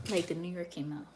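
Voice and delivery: ordinary talk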